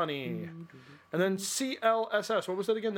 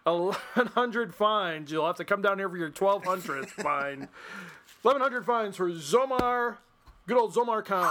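usual speaking voice

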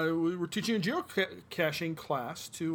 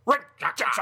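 loud announcer call; announcer shout; 8 semitones higher, 6 dB louder than usual. Acted. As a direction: call the name out like a host. Announces name loudly.

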